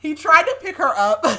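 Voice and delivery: high, loud, laughing through the words. Real, not acted. Reader retelling the failed lift, cracking up laughing. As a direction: barely get it out for laughing.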